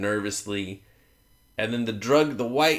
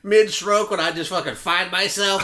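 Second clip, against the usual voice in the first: normal talking voice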